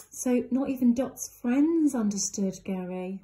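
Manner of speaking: ordinary delivery, nothing marked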